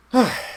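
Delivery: high, loud puff of relief; startled exhale. Acted. Eli recovering from being startled, loud sigh. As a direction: blow out the fright audibly.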